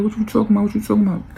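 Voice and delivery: high, repeated mock protest; protesting denial. Cousin brushes off being chased, high-pitched protest. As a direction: play the cousin acting clueless.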